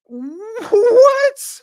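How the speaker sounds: high, loud disbelieving shriek of "What?"; incredulous wail; shocked outcry. Real, not acted. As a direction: scream it in utter disbelief. Reacting in disbelief.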